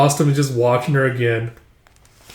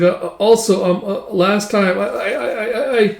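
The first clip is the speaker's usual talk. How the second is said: higher-pitched, halting stammer; flustered, stuck on the first word; embarrassed stammer; 7 semitones higher than usual. Acted. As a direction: stumble nervously over the opening words. Embarrassed, stumbling attempt to apologise.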